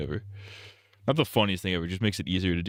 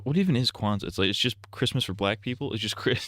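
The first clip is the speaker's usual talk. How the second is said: normal talking voice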